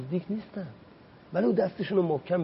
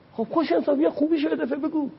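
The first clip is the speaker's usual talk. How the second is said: higher, pleading and scolding at once; exasperated rebuke; 11 semitones higher than usual. Retold or imitated. Speaker chiding an imagined fault-finding MP, high exasperated. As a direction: throw it up at him in disbelief.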